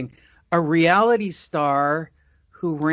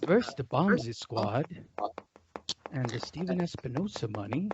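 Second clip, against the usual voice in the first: Deontay Wilder quiet voice; 4 semitones lower, 9 dB quieter than usual. Retold or imitated. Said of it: "when I do Deontay Wilder".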